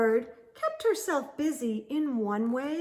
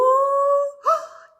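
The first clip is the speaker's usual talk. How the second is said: excited gasp; 10 dB louder than usual. Acted. Thrilled at her own hoot.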